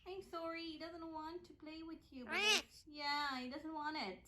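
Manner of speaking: very soft apology; quiet. Real, not acted. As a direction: murmur it gently to the pet.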